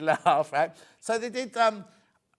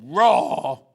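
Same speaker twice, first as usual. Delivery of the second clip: mock roar; 11 dB louder than usual. Saying a dramatic collection name.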